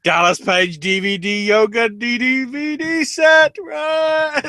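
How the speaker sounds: high, loud, hammed-up gift reveal; mock excited reveal. Mocking the couple's gift, a high loud excited squeal.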